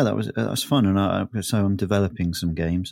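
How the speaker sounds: ordinary talk, nothing marked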